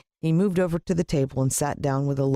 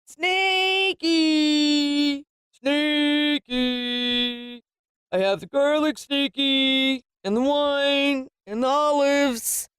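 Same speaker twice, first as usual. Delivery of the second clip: gloating villain; 4 semitones higher than usual. Acted. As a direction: croon to the pet snake, greedy and gleeful. Villain calls his pet snake.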